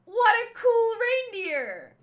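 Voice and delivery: loud, high excited cry; excited shout. Real, not acted. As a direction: shout it out in delight.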